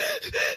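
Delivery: mimicked panicky gasping; imitated hyperventilating gasp. Son when the door opens, imitated hyperventilating.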